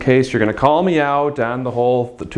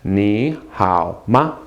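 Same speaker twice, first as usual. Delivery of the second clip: very slow "Ni hao ma", word by word; low, measured repeat-after-me delivery; slow model phrase; 4 semitones lower, much slower than usual. Shown as a demonstration. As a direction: lead learners through "Ni hao ma" slowly. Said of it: "Say that with me".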